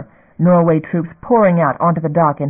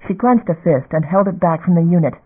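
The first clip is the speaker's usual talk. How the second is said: usual speaking voice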